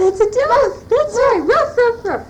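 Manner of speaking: high, loud mock barking; excited praise. Shown as a demonstration. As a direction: cheer the toddler on, then bark. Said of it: "what does the dog say?".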